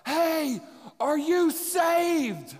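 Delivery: loud, high, drawn-out shout; mock yelling right in someone's face; imitated yell. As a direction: holler it slowly over noisy machines, imitating a coworker. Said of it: "he yells out".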